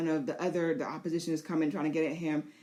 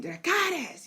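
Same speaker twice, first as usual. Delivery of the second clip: high, firm fire command; high-pitched order to burn; rider's command; 11 semitones higher than usual. Pretend. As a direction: call the dragon to breathe fire. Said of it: "And she's saying".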